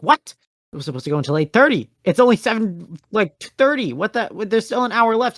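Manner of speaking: high, loud imitation of a stunned friend; hammed-up incredulous outburst; shocked protest. Acting out the friend's shocked reaction.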